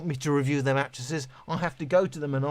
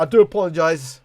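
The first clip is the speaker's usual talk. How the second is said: loud, hammed-up formal apology; mock apology; 9 dB louder than usual. Streamer apologising to chat after a burp, loud and mock-formal. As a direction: boom out a pompous sorry. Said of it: "I burped again".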